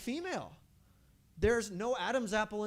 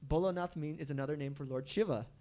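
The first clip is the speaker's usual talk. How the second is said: ordinary talk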